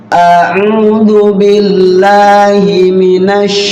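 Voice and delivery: very slow a'udzu billahi with firm ain; drawn-out, precise recitation; clear articulation. Shown as a demonstration. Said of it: "keluarin dari sini dulu alif". (bacaan tegas dan lambat)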